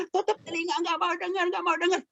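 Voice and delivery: put-on high-pitched childish refusal; refusing children. Children shutting out papa and mama's advice, high-pitched refusal. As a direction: chant it high like kids covering their ears.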